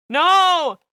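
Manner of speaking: sudden loud cry of no; horrified shout. Acted. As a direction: yell it out in horror. Snapping out of the urge.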